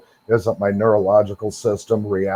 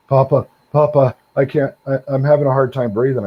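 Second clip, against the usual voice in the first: put-on higher and louder, a frightened boy gasping for his grandpa; scared grandson; 5 semitones higher, 3 dB louder than usual. Grandson calling to his grandpa, can't breathe, frightened, higher and louder.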